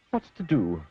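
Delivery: plain reading voice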